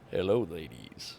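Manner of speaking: very low smooth announcer voice; deep suave ad-man greeting; Old Spice guy. Pretend. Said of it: "I have too much fun with my voices".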